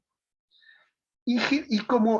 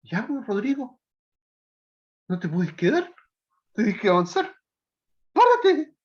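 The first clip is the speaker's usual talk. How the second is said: a loving mother urging her son to stand up; imitating his mother; 3 semitones higher than usual. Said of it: "me diría algo así como".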